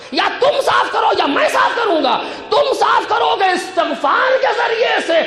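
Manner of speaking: high, ringing sermon exhortation; impassioned preaching. Acted. Preacher urging the congregation to clean up their character, impassioned. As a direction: drive each demand home to the congregation.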